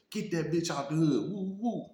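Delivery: high, mocking warning as they leave; parting threat. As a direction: sneer a final warning. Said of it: "They like".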